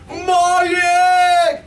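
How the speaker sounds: high, loud cry of an elephant; shouted. A narrator's reading. Horton hears his egg squeak, high loud shout. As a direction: call it out high in alarm. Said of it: "shouted Horton".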